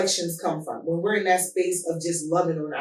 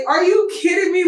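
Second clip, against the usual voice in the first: high-pitched laughing cry of disbelief, much louder; 12 semitones higher, 6 dB louder than usual. Retold or imitated. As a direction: shriek it through laughter, floored by Dad's answer. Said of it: "we was cracking up".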